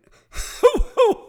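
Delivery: loud, high booming laugh; old guy's hearty guffaw; big belly laugh. Retold or imitated. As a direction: let out a jolly roar of laughter. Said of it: "he went".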